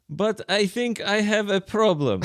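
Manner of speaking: lightly imitated Russian accent. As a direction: read the message in a Russian accent. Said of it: "Should I do the Russian accent?".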